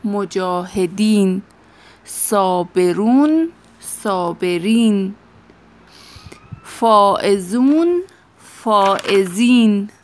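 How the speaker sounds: "مجاهدین، صابرون، صابرین، فائزون، فائزین" each drawn out, endings stressed; slow model pronunciation. Shown as a demonstration. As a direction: read the plurals slowly like a drill. (تلفظ آهسته و تأکیدی پسوندها)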